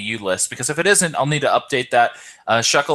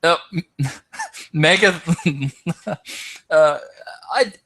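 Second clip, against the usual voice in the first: laughing; 6 semitones higher than usual. Real, not acted. Laughs at surprise Mega pick.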